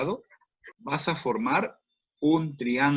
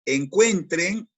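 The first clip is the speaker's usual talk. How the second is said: encuentren drawn out slowly and louder; stretched emphasis; 5 semitones higher, 5 dB louder, much slower than usual. Shown as a demonstration. Teacher urging the class to find the forces, stretched and louder. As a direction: lean on encuentren, slow and loud.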